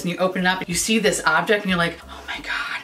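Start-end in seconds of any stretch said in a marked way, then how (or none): 2.1-2.8 hushed, voiceless wonder; awed whisper. Acted. Finder opening an antique in the attic, awed whisper. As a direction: whisper it as if finding treasure. Acting awe at a discovery.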